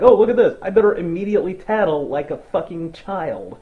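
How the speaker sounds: put-on loud, high childish tattle; mocking tattletale. Mocking the blonde vampire as a child, loud tattletale. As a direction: whine it like a tattling kid. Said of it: "You're just like".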